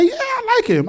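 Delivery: high-pitched mock daughter; daughter's voice. Daughter's reply voiced by father.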